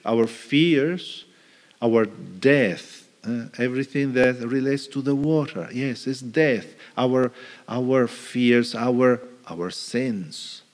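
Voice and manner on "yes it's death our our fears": ordinary talk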